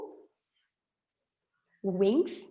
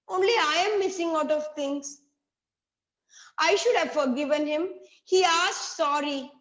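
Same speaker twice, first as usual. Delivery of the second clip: plain reading voice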